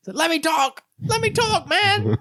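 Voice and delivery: squeaky falsetto protest; high-pitched voice. Retold or imitated. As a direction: imitate a player pleading in a tiny, very high voice. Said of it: "got a pretty high voice too".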